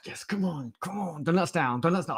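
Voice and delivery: high, playful coaxing; mock pleading. Host urging viewers to donate, mock pleading.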